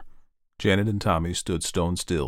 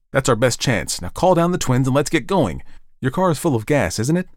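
louder, higher, brisk commands; urgent Ed; 6 semitones higher, 5 dB louder than usual. Acted. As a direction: push the family to get going.

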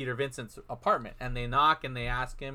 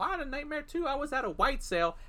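higher-pitched imitation of a mother; lightly voiced mom; 10 semitones higher than usual. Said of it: "And she's like".

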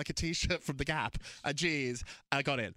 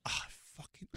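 whispered blurt; 9 dB quieter than usual. Real, not acted. Blurts out a crush.

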